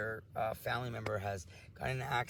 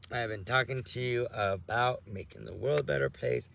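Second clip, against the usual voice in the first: usual speaking voice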